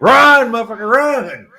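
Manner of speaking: loud, high-pitched yell to flee; mock panicked shout. Imitating followers whose leader was just beheaded, mock panicked shout. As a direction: yell it like a comic stampede warning.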